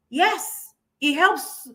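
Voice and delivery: ordinary delivery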